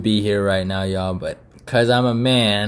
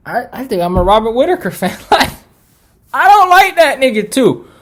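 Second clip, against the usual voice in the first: laughing exclamation; 13 semitones higher, 6 dB louder than usual. Real, not acted. Laughing at his own admission.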